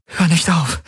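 high-pitched imploring; pleading. A narrator's reading. Kirai begs her lover to continue, pleading high. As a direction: plead with the pitch lifted right up. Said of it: "flehte sie".

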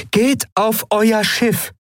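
high, slow alien command; commanding order. A narrator's reading. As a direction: order them to the ship, slow and firm. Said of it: "befahl Aylan".

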